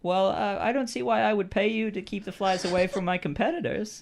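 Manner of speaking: high-pitched, dismissive fruit seller; game character fruit seller. Acted. Merchant turning down the fly-keeper's offer, high character voice. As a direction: play a reluctant merchant in a high voice.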